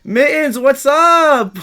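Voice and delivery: loud, high-pitched hello; excited greeting. Real, not acted. Streamer greets Mittens in chat, excited. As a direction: holler a hearty hail to a friend.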